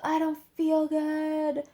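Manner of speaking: high, slow, whiny voice of a queasy patient; sick girl whining. Retold or imitated. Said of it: "she's like".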